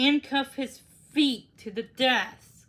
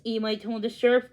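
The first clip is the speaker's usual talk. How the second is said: usual speaking voice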